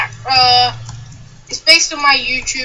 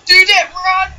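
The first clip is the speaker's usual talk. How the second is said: urgent shout to run, higher and louder; being chased; 7 semitones higher, 7 dB louder than usual. Real, not acted. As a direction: yell at friends to flee. Fleeing maniacs in game.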